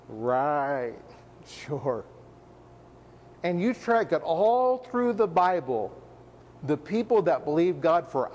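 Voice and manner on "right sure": low, drawn-out sarcastic agreement; mock disbelief. Onlooker answering an old couple expecting a son, dryly doubtful. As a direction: lightly humor them without believing a word.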